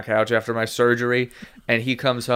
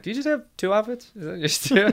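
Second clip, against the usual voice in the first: mock disbelief; 9 semitones higher, 4 dB quieter than usual. Mocking friend's outfits.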